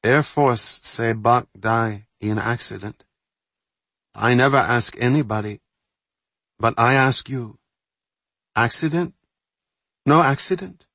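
put-on pleading accented voice; accented English. Carol pleads about Buck.

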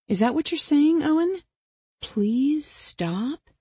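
high, rising, teasing question; mock questioning. Killer checks her victim's plea, mock questioning. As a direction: query him in a high lilt, stretching the plea.

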